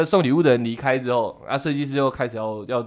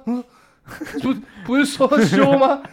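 stunned; 10 semitones higher than usual. Retold or imitated. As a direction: ask in shocked disbelief, half laughing. Retold shocked reaction. (又惊又笑地问)

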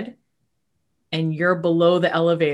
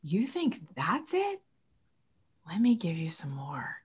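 hushed divine voice; 9 dB quieter than usual. Acted. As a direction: tempt them gently with more blessings. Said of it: "God says".

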